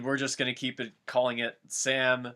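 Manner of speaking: normal talking voice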